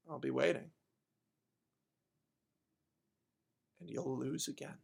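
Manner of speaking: low, very soft reply; acted hushed resolve; quiet defiance. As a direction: answer the threat calmly, with long pauses. Heroine's quiet answer to threat.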